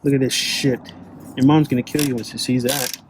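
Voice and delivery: ordinary talk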